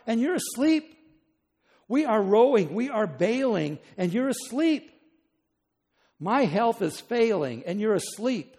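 exasperated, hammering complaint; yelling at God. Acted. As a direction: shout your grievances up at a sleeping God. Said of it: "You'd yell at him too".

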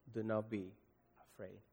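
tender reassurance. Acted. Jesus comforting, fading voice.

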